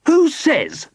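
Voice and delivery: very high-pitched retort; defiant yell. A narrator's reading. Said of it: "yelled Squidgy".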